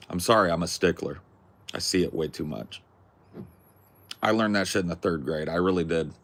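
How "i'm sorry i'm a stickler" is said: ordinary talk, nothing marked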